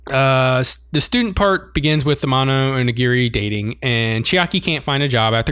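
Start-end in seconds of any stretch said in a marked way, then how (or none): none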